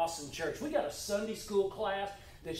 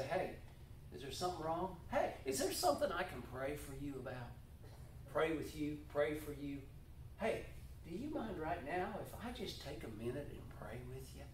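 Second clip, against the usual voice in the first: softer, gentle offer to pray; gentle caring offer; 2 semitones lower, 8 dB quieter than usual. Shown as a demonstration. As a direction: speak tenderly to someone looking down. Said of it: "and say".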